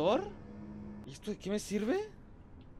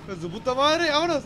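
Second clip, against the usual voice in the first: frantic; 9 semitones higher, 8 dB louder than usual. Real, not acted. Fleeing the monster.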